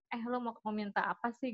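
hushed mock offer; hushed quote. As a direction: say it quietly as if sharing a secret. Retelling a hypothetical offer, hushed.

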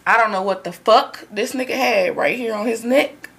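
normal talking voice

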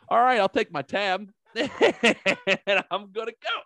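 high, sing-song "I'll take my tab"; laughing singsong goodbye; mock breezy exit. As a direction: leave cheerfully as if unbothered. Retelling his exit from the bar.

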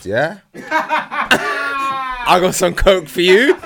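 high, slow offer broken by laughter; laughing sleazy offer. Retold or imitated. A man chuckling his offer of coke to a girl. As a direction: lay on the sly offer while cracking up.

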